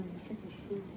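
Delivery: distant muttered echo of the question; faint murmur. Acted. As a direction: let it fade almost to nothing. Repeated even more quietly.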